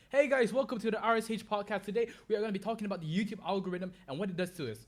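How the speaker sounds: high, loud, rapid-fire podcast intro; hyped newer-style YouTuber. Shown as a demonstration. YouTuber showing how newer creators open a podcast, hyped and fast. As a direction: rattle off an over-enthusiastic YouTube greeting. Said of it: "They tend to speak like this".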